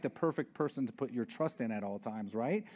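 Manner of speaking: ordinary delivery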